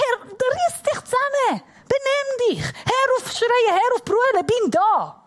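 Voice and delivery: high, exasperated acted scolding; insistent retold telling-off; annoyed father. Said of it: "hat er sich genervt".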